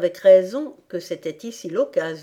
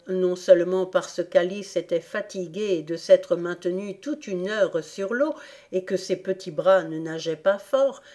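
ordinary delivery, nothing marked

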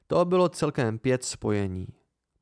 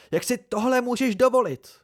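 high, loud indignant exclamation; outraged cry; 12 semitones higher, 5 dB louder than usual. A narrator's reading. Devi protesting at being bound, outraged cry. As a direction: pitch leaps up in a loud protest. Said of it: "vykřikla".

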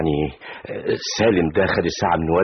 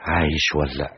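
low, softer wondering to oneself; pensive musing; 6 semitones lower, 6 dB quieter than usual. Acted. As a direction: wonder quietly about a missing father. Musing alone about missing father. (تساؤل حزين بصوت واطي)

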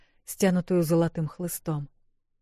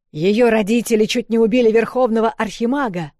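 loud, higher accusing shout; angry crowd shout; 5 semitones higher, 7 dB louder than usual. A narrator's reading.

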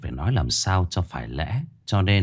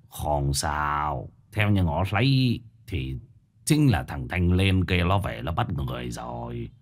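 put-on low, slow, soft old master's voice; old spirit master, composed; 6 semitones lower, 4 dB quieter, slower than usual. A narrator's reading. Old master promises to seal the spirit tonight, low and composed. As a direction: state the remedy gravely and unhurriedly.

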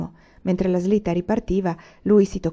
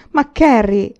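higher, louder protest; exclaiming; 4 semitones higher, 6 dB louder, slower than usual. A narrator's reading. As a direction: exclaim it at your sister, raised in pitch and volume. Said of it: "esclamò Laura".

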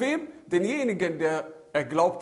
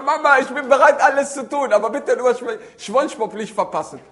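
higher, louder mock plea; pleading child; 5 semitones higher, 9 dB louder than usual. Child bargaining with Mama over a punishment, mock pleading. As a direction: beg Mama like a punished kid, lightly hammed up.

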